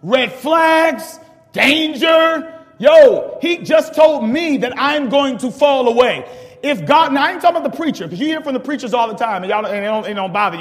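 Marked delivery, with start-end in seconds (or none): 0.0-6.3 shouted, slow and much louder; alarmed shout. Real, not acted. Preacher shouting the warning to the congregation, slow and loud.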